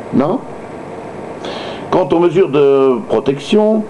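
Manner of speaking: usual speaking voice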